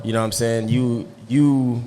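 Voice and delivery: ordinary talk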